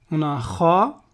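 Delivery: usual speaking voice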